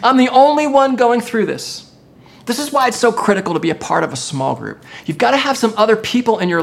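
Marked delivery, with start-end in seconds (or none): 0.0-1.9 high-pitched lament of feeling alone; acted outburst of someone spiritually empty; self-pitying complaint. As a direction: plead it high, as if nobody else understands. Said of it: "we start to say things like".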